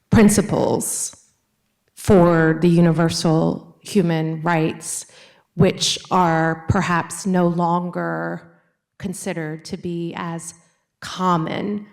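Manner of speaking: slow, measured phrases with pauses; slowed down on request. Real, not acted. Audience questioner repeating for a professor who could not follow, slowly. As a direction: say it slowly, leaving gaps between phrases. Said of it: "could you speak a little more slowly".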